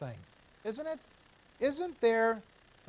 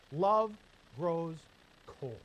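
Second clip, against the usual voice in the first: Love punched, cold dropped to a hush; each word apart; much slower than usual. Real, not acted. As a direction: let Love grows cold sink word by word. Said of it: "word-by-word, dramatic drop".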